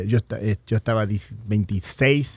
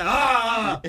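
mock yell; 7 semitones higher than usual. Imitating the manager yelling.

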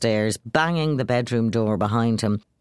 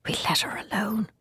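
softer, unvoiced whisper; 8 semitones higher, 5 dB quieter than usual. Father whispering to Jim to leave her alone. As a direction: whisper it so she can't hear. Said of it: "he whispered".

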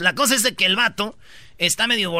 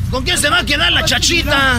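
louder, higher movie-drama cry; Pepe el Toro melodrama; 6 semitones higher, 5 dB louder than usual. Retold or imitated. Host hamming up a melodramatic movie line, louder and higher. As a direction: wail it like an old Mexican film. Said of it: "así como".

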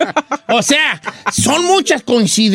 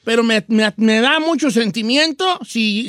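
ordinary talk, nothing marked

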